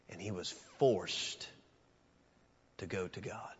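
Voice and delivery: whispered, soft and low; hushed whisper. Real, not acted. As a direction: breathe the words out. Climax of quiet sermon passage.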